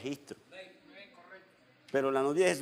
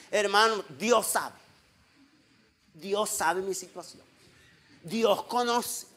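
higher, imitated pleading excuse; mock excuse-maker; 6 semitones higher, 5 dB louder, slower than usual. Pastor imitating members making excuses, mock pleading. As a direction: plead it as another person's excuse. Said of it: "Hay hermanos que uno dice".

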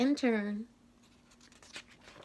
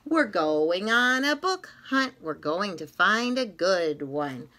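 usual speaking voice

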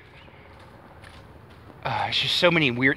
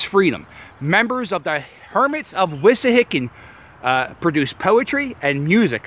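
reading a sign aloud; 8 semitones higher, 4 dB louder than usual. Acted. Reads the historical marker aloud.

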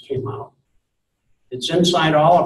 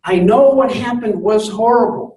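a touch higher and louder, sincere pleading to a friend; earnest advice; 7 semitones higher, 4 dB louder than usual. Pretend. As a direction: urge a hurting friend gently but firmly. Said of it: "and say".

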